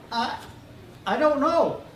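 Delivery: put-on high, timid child voice; a sick kid unsure; small boy. A narrator's reading. Boy voiced in read story.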